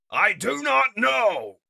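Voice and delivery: loud, high, stretched words; shouted answer. Acted. Comrade answers Wheeljack under fire, shouting. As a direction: shout each word emphatically.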